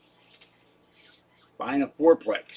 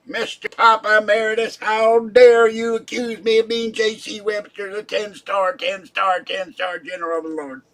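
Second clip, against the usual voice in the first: higher and louder, pompous indignant; ten-star general persona; 7 semitones higher, 5 dB louder than usual. Acted. Scolding Papa Emeritus as the ten-star general, loud pompous outrage. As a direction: puff up with outrage at the accusation. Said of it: "you get into that persona".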